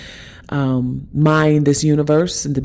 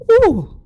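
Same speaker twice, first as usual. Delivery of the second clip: high, loosed "ooh" as feeling rises; real high-pitched exclamation of emotion; emotional ooh; 4 dB louder than usual. Real, not acted. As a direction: let out a high, moved "ooh". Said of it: "And I'm getting emotional".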